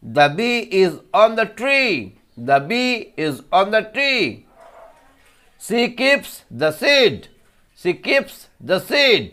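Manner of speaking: teacher demonstrating pronunciation; slow model reading. Shown as a demonstration. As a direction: read it slowly for the class to repeat. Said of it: "একবার উচ্চারণটা করে দেই".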